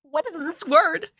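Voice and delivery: high, squeaky mock confusion; baffled squeak. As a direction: play the lost viewer, squeaking. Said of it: "I was like".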